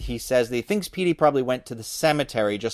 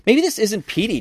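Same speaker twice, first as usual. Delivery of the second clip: wondering aloud on a raised pitch; thoughtful; 9 semitones higher than usual. A narrator's reading. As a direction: muse it as a boy with a doubt, pitched high. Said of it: "Josh said thoughtfully".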